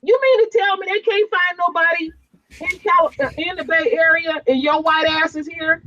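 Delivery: high-pitched indignant retort; retold incredulous challenge, high-voiced; in-his-face confrontation. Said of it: "That's how I said it right in".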